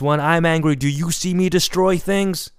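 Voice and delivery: loud, high-pitched retold rebuke; angry father scolding. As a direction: imitate a parent yelling an accusing question.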